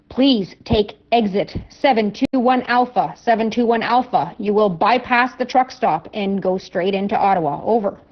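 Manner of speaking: usual speaking voice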